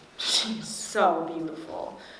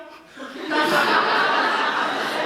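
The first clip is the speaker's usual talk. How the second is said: breathless giggle; 9 semitones higher, 5 dB louder than usual. Acted. Girl laughing at mom's question.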